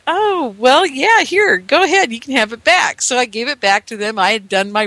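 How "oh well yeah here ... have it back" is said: put-on sheepish child. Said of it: "uh, I said".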